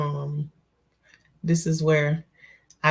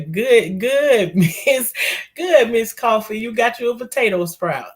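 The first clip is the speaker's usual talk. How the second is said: excited; 5 semitones higher, 5 dB louder than usual. Real, not acted. Excited greeting to viewer Miss Coffee.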